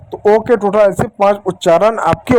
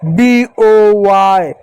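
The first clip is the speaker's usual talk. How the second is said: letter names drawn out very slowly; spelled out slowly; much slower than usual. Shown as a demonstration. Teacher spelling letter names to students, drawn out slowly. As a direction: spell each letter name out, stretching it. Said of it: "ये तो सिर्फ उनका नाम आ रहा है".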